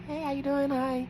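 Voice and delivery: high soft smiling hello; smiling greeting. Retold or imitated. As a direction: smile through the greeting. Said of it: "I'm smiling".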